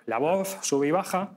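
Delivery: normal talking voice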